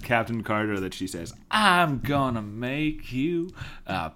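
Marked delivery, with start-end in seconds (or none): 1.5-4.1 slow, drawn-out come-on; acted sultry line. She offers Sam a pie, slow and suggestive. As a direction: draw out each word suggestively. Quotes a character's flirty line.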